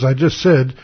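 ordinary delivery

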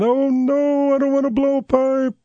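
very high, whiny mock-scared voter; mock panic. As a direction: imitate a frightened voter in a high wail.

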